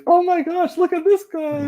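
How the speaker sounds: imagined Russians thrilled by the streamer, high excited voice; amazed onlooker. Retold or imitated. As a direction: squeal it like a delighted fan. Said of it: "you would be like".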